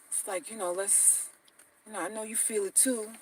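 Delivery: low murmur, leaning in close; hushed sweet talk. Acted. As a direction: coax her in a near-hush. Guy sweet-talking on third date.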